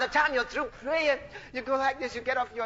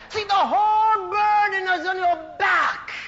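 full-force preaching; 7 semitones higher, 6 dB louder than usual. Real, not acted. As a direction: cry it out, stretching the key words.

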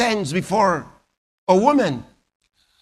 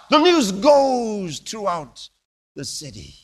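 usual speaking voice

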